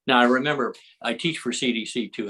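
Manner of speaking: ordinary talk, nothing marked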